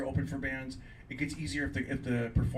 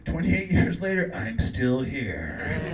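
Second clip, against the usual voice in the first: slow and loud, each word landed; slow triumphant close; 7 dB louder, much slower than usual. Real, not acted. Comic closes his story to the crowd, slow and proud. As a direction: land the comeback line slowly. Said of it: "closing line of his set".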